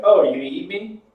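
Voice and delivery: loud, high-pitched affronted question; mock offence. New Zealander friend reacting to kiwi being eaten, mock affronted. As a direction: act out being scandalized, voice jumping up. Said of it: "He'd be like".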